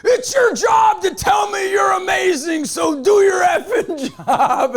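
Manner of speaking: high, loud yell that slows and breaks into a laugh; homeless man yelling. Retold or imitated. Homeless man demanding to hear he's amazing, loud laughing yell. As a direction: bellow the order, then crack up. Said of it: "'cause he said".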